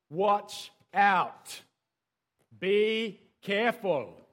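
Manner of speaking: loud, drawn-out herald's warning; scary warning. Acted. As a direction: call it out slowly and ominously. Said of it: "a bit scary".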